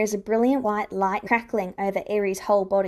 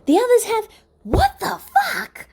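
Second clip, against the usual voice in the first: high, loud, slow disbelieving cry; a friend stunned to see someone alive; shocked outburst; 14 semitones higher, 6 dB louder than usual. A narrator's reading. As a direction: break off into a shocked yell. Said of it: "Hisashi demands".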